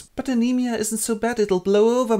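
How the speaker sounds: put-on high, innocent girlish voice; breezy, clueless young girl brushing it off; naive girl. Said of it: "and Wendla goes".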